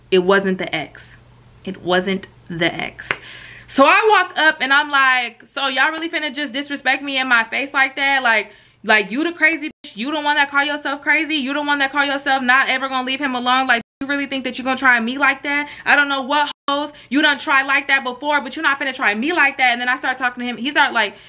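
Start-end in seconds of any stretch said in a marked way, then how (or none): 0.1-3.1 slow, measured admission spaced out word by word; slow deliberate reveal. Real, not acted. Storyteller admitting the spoiler to viewers, slow and deliberate. 5.5-19.7 higher-pitched indignant challenge; acted confrontation. Girlfriend confronting the supposed ex, indignant and higher.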